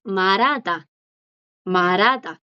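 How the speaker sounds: 말하다 as "ma-ra-da", silent H; common pronunciation. Shown as a demonstration. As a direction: link the L into the vowel in 말하다, dropping the H. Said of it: "la pronunciación más común es". (말하다 pronunciado "marada", sin la jota)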